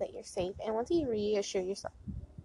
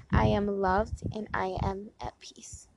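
whispered affirmation. Shown as a demonstration. Whispering an affirmation to her viewers on the porch. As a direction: breathe the words out, no voice, slowly. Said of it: "I'm sorry, one more time".

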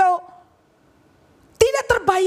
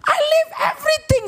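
much higher shouted question; incredulous shout; 10 semitones higher than usual. Retold or imitated. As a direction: yell it in disbelief. Peter's disbelief acted out.

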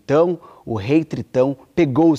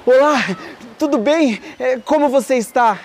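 put-on higher, brighter young prince greeting; 7 semitones higher than usual. The prince greets the red-haired girl, high and eager. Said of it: "o príncipe disse".

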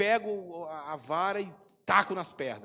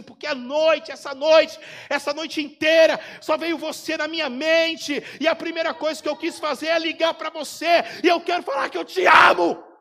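much louder and higher, shouting with emotion; crying friend's outburst; 9 semitones higher, 13 dB louder than usual. Retold or imitated. Friend calling the pastor, shouting his love through tears. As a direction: shout it tearfully, overwhelmed. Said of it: "ele começa a chorar e fala".

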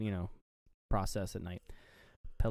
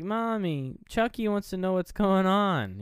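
put-on high, slow little boy voice; lightly voiced child; mock little kid; 8 semitones higher, 4 dB louder, slower than usual. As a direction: squeak it out like a small child. Child Andy voiced in retelling.

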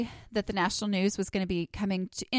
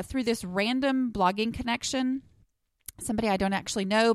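ordinary talk

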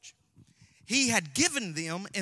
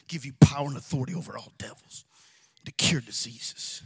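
lower, whispered, savoring each word; hushed whisper; 8 semitones lower than usual. Shown as a demonstration. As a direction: breathe the verse out like a secret. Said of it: "don't you just feel that".

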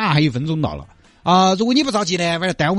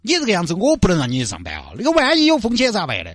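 ordinary talk

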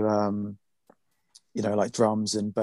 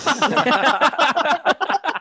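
big laugh; 7 dB louder than usual. Real, not acted. Laughing at own impression.

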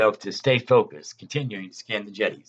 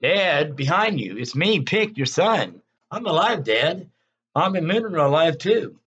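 raised, urgent calling to his father; calling louder; 5 semitones higher, 3 dB louder than usual. A narrator's reading. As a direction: call out to get his attention. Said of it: "Pick spoke a little louder".

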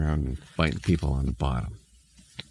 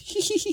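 high, squeaky little hee-hee; mischievous high-pitched imp snicker; imp giggle. Acted. Imp about to bite a bottom, high giggle. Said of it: "giggling and running away".

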